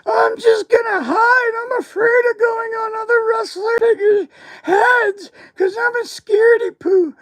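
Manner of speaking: very high, frightened crying squeal; scared Christmas hat. Acted. Giving the runaway Santa hat a voice, frightened crying squeal. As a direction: squeal like a terrified little hat hiding away. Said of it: "and it said".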